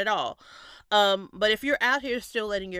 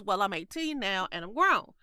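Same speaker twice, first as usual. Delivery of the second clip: normal talking voice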